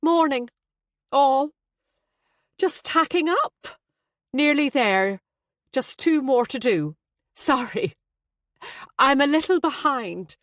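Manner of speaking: high, effortful brightness; covering upset with put-on cheer; forced cheer. A narrator's reading. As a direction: greet through a fake smile. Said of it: "gave us a fake smile".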